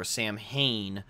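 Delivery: Samhain sounded out slowly as SAM-HAIN; alternative spelling pronunciation. Shown as a demonstration. Host offering another reading of the word, slow SAM-HAIN for Samhain. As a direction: say Samhain as written, sam plus hain rhyming with rain. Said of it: "it looks like".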